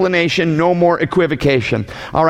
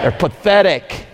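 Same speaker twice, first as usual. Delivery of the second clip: slow, scornful They're pathetic; with disdain; 2 semitones higher than usual. Shown as a demonstration. Preacher showing the crowd how to say it, full of disdain. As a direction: drag out pathetic with contempt. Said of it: "say it with disdain".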